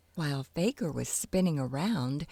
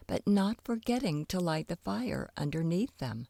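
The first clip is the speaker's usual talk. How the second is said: plain reading voice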